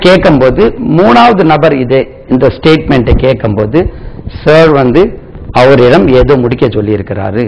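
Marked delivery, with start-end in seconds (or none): none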